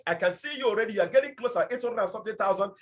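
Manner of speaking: usual speaking voice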